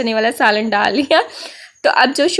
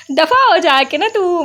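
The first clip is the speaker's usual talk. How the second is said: slow, higher retelling of mom snapping; mock angry scolding; 5 semitones higher than usual. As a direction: imitate mom telling her to get lost. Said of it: "मम्मा ना एकदम गुस्से में".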